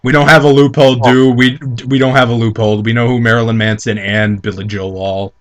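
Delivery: ordinary talk, nothing marked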